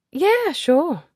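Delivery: loud, high, teasing aristocratic friend; brash society lady. Acted. Ezzy greeting Nicholas at the party, loud and teasing. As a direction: greet him with a bawdy, affectionate jab. Ezzy agrees to mind Olivia.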